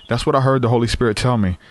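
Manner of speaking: normal talking voice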